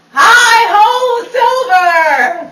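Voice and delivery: loud, high rallying cry; hammed-up hero's holler; heroic call. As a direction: belt out a cowboy's call to his horse. Mimics a famous heroic call.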